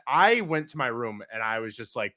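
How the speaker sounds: ordinary talk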